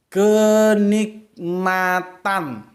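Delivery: "kenikmatan" drawn out very slowly and loud with a falling end; stressed slowly. Shown as a demonstration. Teacher stressing the meaning of the word, very slow. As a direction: stretch every syllable of "kenikmatan". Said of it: "Maka kalau kamu lihat ya".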